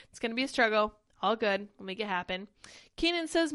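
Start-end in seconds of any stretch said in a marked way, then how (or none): none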